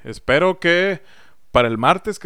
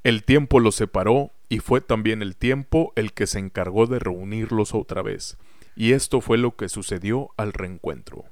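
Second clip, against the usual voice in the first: story narration; slower than usual. Acted. Narrator introduces the sketch.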